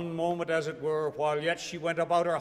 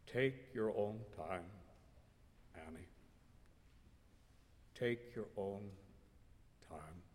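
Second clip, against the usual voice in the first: very soft, low, trembling; shaking soft voice; 6 semitones lower, 11 dB quieter than usual. A narrator's reading. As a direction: hold back longing, barely steady. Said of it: "his voice shaking a little".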